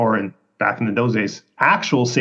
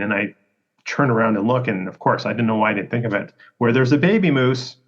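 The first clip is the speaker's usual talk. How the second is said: usual speaking voice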